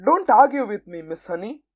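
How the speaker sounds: ordinary delivery, nothing marked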